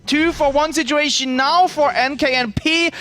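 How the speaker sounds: high-pitched, urgent match commentary; live play-by-play. Real, not acted. Caster calling Medic's push to viewers, high-pitched play-by-play.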